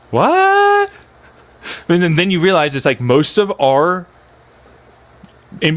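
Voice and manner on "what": very high, drawn-out squeak of "what"; mock disbelief. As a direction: squeal it in exaggerated bafflement.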